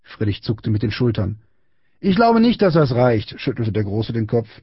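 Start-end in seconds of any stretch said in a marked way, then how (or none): 2.0-3.4 high mock-regretful taunt; taunting bully. A narrator's reading. Big boy rejecting Friedrich's apology, high taunting voice. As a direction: toy with your victim.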